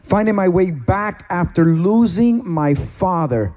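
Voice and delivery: ordinary delivery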